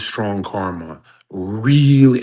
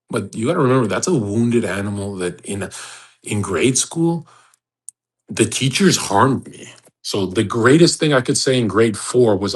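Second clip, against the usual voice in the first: usual speaking voice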